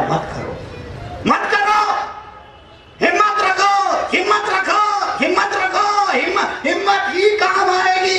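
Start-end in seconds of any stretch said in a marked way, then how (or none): 1.3-5.9 high, stretched exhorting cry; rally them with a high, full cry. Real, not acted. Preacher urging the crowd to take courage, rallying. 6.1-8.2 high, ringing exhortation; rallying cry. Real, not acted. Preacher telling the crowd courage will help, rallying.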